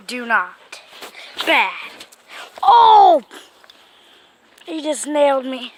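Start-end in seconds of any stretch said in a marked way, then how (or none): 2.6-3.2 sharp high loud yelp of pain. Real, not acted. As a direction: cry out as the claws hit. Cat scratches him on arm.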